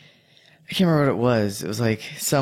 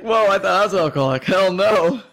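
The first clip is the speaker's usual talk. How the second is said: mock relief; 8 semitones higher, 4 dB louder than usual. Mock relief at others drinking.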